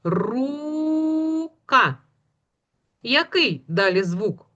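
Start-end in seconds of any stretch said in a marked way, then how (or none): 0.0-2.0 рука with a very long high У, short КА; second sound drawn out. Shown as a demonstration. Teacher asking for the next sound, vowel stretched. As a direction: hold the У in рука for children to hear. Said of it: "Далі слухайте звук".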